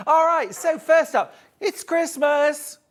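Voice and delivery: big bright Christmas announcement; excited announcing. Real, not acted. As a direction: burst out with the news.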